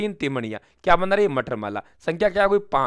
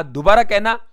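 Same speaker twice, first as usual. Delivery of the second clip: indignant demand; 5 semitones higher, 7 dB louder than usual. Acted.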